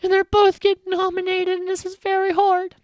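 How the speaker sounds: high, loud wailing imitation of a drunk cry; hammed-up tearful diary room confession; mock drunken cry. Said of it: "has that drunken cry thing where she's like".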